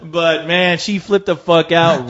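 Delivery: normal talking voice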